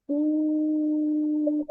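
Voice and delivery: "У" held long and steady; long drawn У. Shown as a demonstration. Teacher to child, showing how to say "У" long. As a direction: sing out "У" slowly for the child to copy. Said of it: "А ну промов звук У".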